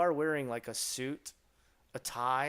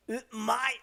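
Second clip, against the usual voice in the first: high delighted "umai!"; mock delight; 10 semitones higher, 6 dB louder than usual. Streamer teaching chat to praise food, high delighted cry. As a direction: cry out how tasty it is. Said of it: "or, um".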